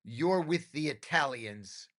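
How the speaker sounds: slow and dramatic, a hint of movie godfather; cinematic mob boss. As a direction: play it like a gangster film underboss. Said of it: "very cinematically said".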